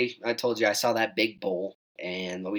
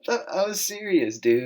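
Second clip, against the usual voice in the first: high, slowed incredulous protest; exasperated protest; 10 semitones higher, slower than usual. Retold or imitated. Insisting to cousin he was serious, exasperated. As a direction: drag out serious in disbelief.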